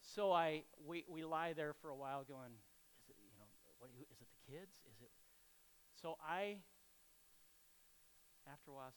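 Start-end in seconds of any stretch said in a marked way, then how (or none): 3.0-5.1 very soft, quick, low questions; hushed night whispering. Retold or imitated. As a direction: whisper hurriedly, as if lying in bed. Couple whispering in bed at night.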